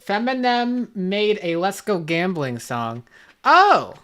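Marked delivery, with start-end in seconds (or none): 3.4-3.9 sudden high, loud oh; startled oh; surprised oh. Real, not acted. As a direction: gasp out a surprised oh. Surprised by the news.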